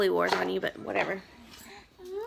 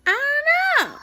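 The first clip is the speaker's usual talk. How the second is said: very high, loud whine; hammed-up kid whine; whiny child; 8 dB louder than usual. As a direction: kid who can't decide. Son's whiny reply.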